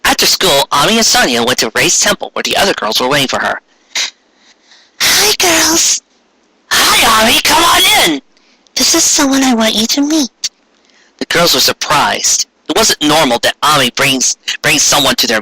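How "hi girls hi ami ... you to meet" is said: high, cheerful greetings; bright schoolgirl hellos; girls' voices. A narrator's reading. The girls welcoming Ami at the temple, high.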